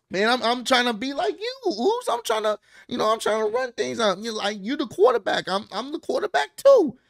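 high, whiny, eager voice; Jimmy pleading with Roman in a high, eager voice; imitating Jimmy. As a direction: play the overeager little brother pleading his case. Jimmy explains himself to Roman.

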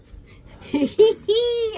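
loud high laugh; high burst of laughter. Real, not acted. Laughing at own joke.

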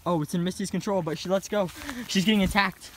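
lightly voiced play-by-play; sports commentator. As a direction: call the match like commentary. Mock sports commentary on animals.

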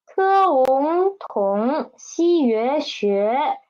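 sounding out each initial and final slowly; spelled out slowly. Shown as a demonstration. Teacher sounding out syllables for students, slowly. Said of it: "The initial 特 and the final 翁".

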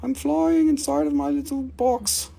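high, squeaky little cube voice; voice of a flying pyrite cube. Acted. Seller voicing his pyrite cube, squeaky high. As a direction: give the tiny cube a chirpy voice. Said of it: "It's like".

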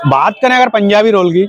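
speaking between bites; talking while chewing. Real, not acted. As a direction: mouth partly full of food. Seen 0: Bit roll at -1.0; mouth seems busy chewing until about 1.8.

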